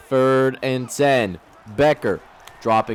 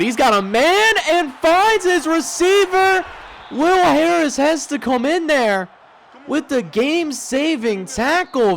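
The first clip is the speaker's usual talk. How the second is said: excited; 11 semitones higher than usual. Real, not acted. Opponent's big completion.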